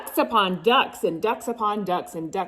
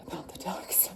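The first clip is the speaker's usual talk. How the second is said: whisper; 12 dB quieter, faster than usual. Real, not acted. Aside whisper.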